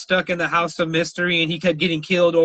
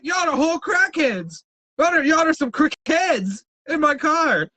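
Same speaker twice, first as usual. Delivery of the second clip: mock yell at crackheads; 8 semitones higher than usual. Yelling at departing passengers.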